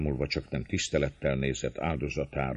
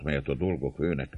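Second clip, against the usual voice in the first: usual speaking voice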